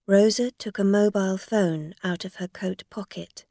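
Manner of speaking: usual speaking voice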